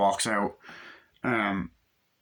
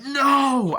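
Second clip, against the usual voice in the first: high, loud drawn-out movie cry; anguished shout; 8 dB louder than usual. Retold or imitated. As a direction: imitate a man crying out at a gunshot. Acting out man who gets shot.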